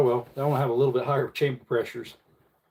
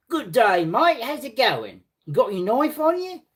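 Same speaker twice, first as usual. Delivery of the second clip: higher, hammed-up Aussie banter; mock Australian accent; 10 semitones higher than usual. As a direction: play the cheeky outback bloke. Continues Aussie bit.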